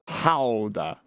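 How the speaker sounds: Gouda with a throaty H-like start, HOW-da; the Dutch way to say Gouda, HOW-da. Shown as a demonstration. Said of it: "How to pronounce, okay, here it is".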